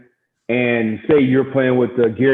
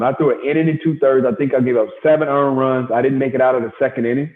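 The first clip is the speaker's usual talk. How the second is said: ordinary delivery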